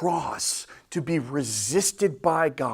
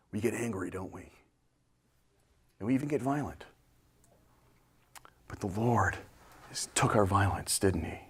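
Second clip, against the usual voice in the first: ordinary talk, nothing marked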